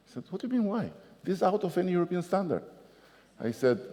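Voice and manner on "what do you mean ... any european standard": pitched way up and rushed; hysterical French supervisor. Retold or imitated. Supervisor scolding the mayor about EU standards, hysterical. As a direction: spit it out fast in disbelief.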